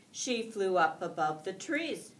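ordinary delivery

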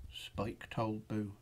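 ordinary delivery

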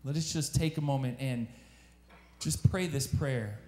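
soft, low voice leading a prayer over music. Acted. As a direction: gently invite them to close their eyes and pray.

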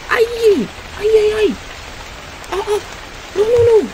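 high, frantic cries; terrified high yelling; panicked screams. Real, not acted. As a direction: yelp in real panic. Chased in horror game.

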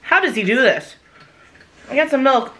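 ordinary talk, nothing marked

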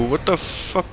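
ordinary talk, nothing marked

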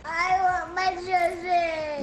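loud tearful wail, words blurred by sobbing; crying loud. Real, not acted. Little kid sobbing loudly at his older brother. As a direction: sob it out at full volume. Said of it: "You done crying?".

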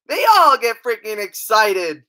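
normal talking voice

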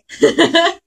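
sudden high laugh; bright burst of laughter. Real, not acted. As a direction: laugh out loud. Reacting to movie.

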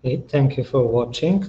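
usual speaking voice

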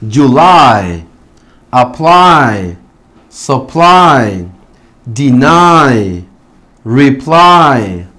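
July, apply, supply, deny, reply as whole words, long falling final "eye"; stress on final syllable. Shown as a demonstration. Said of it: "vamos a pronunciarlas nuevamente". (July, apply, supply, deny, reply con final "ai" fuerte y descendente)

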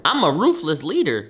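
usual speaking voice

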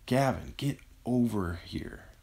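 strained, drawn-out call from a sickbed; coughed out. A narrator's reading. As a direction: call him over with little breath. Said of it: "Eric coughed".

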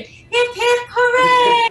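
high, loud cheer; full-throated hip hip hooray; cheering shout. Real, not acted. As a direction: lead the crowd in a big hooray. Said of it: "Can we each just say, uh, Hip, hip, hooray".

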